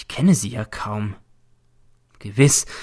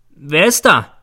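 loud, raised call through a door; 5 semitones higher, 7 dB louder, slower than usual. A narrator's reading. Teacher calls through the door at night, calling out. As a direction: call out to whoever is outside. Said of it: "rufe ich durch die Türe".

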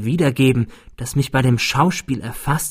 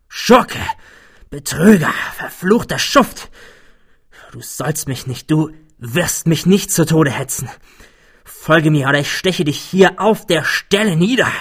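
hoarse angry shouting; furious, hoarse with rage; 4 semitones higher than usual. A narrator's reading. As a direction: spit it out in blind fury. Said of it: "sagte ich mit vor Wut heiserer Stimme".

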